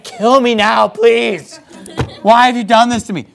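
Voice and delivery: high, pleading mock despair; mock exasperation. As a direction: play up a fed-up plea. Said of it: "he's just like".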